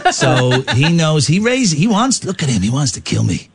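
mock-menacing American mobster; gangster. Henchman threatening the sergeant's little kiddies, mock menace. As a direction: threaten like a hammy movie gangster.

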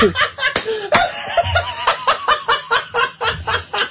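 shrieking giggle fit; high helpless laughter. Real, not acted. Co-host cracks up.

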